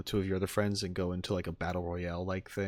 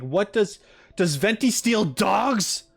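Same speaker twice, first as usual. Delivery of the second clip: loud, high, baffled outburst; confused; 8 semitones higher, 5 dB louder, slower than usual. Retold or imitated. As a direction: blurt it in slow, loud disbelief. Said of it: "I'm just confused. I was like".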